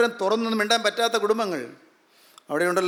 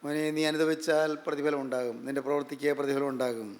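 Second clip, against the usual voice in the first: much softer and lower, like a whisper of comfort; tender reassurance; 8 semitones lower, 9 dB quieter than usual. Acted. Preacher consoling a suffering child, soft and tender. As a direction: speak gently to a hurting son.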